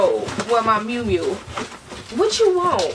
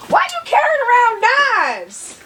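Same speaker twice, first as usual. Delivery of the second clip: high, loud, drawn-out outcry; shocked shout; 12 semitones higher, 5 dB louder, slower than usual. Real, not acted. Wife shocked her husband carries knives, shouting high. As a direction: yelp it in disbelief.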